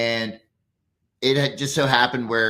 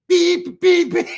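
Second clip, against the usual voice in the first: much higher, loud mock beeps; mock beeping; 8 dB louder, slower than usual. Going off on the old lady, loud high beeps. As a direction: blare beep-beep like a horn. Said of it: "I'm like".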